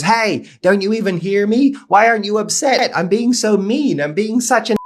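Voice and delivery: high, exasperated heckler; frustrated outburst. Acted. Heckler to the Buddha who ignores him, frustrated outburst. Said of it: "the man gets so frustrated".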